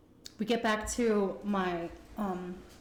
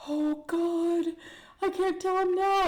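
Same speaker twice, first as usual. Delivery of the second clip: panicked; 11 semitones higher, 4 dB louder, slower than usual. Retold or imitated. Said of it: "And I was like".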